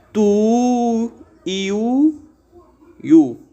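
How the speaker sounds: teacher splitting tuiu into syllables; syllables split apart. Shown as a demonstration. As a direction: break the word into tu, iu, iu. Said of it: "teríamos então que separar essa palavra".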